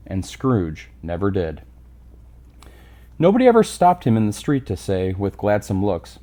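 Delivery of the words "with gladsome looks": plain reading voice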